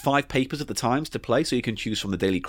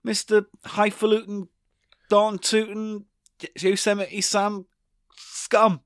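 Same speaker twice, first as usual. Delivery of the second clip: high, spaced-out mock-angry insults; indignant tirade; 8 semitones higher, slower than usual. Host calls co-host names, indignant tirade. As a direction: land each name separately, pitched high.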